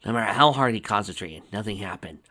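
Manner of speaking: plain reading voice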